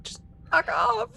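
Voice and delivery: high, strained yell; high-pitched furious battle cry; screamed battle cry. Acted. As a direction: scream it at the enemy while firing. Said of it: "screaming at it, like, doing a battle cry".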